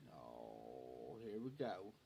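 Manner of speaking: a low, soft dread-filled mutter; low grumbled aside. Retold or imitated. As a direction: mutter it under your breath.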